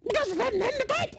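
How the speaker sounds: very high, silly talking-emu voice. Acted. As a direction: squawk it out as a cartoon emu. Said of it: "in my head is how emus talk".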